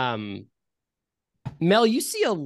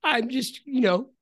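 put-on old man voice; 4 semitones higher than usual. Old man Clarence keeps talking.

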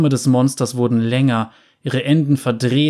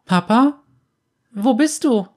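put-on high, louder boy's call, getting anxious; child calling out; 11 semitones higher, 3 dB louder than usual. A narrator's reading. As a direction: call for dad into the dark room. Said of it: "rief Abel".

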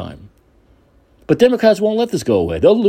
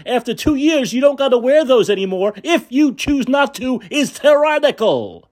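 lightly high mock-outraged Karen voice; a hint of a whiny Karen, slowing at the end; mild mock indignation; 7 semitones higher than usual. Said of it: "But the governor's saying".

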